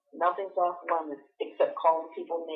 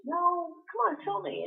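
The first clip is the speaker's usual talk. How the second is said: much higher, playful coaxing; coaxing teacher; 10 semitones higher than usual. Retold or imitated. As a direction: tease the secret out.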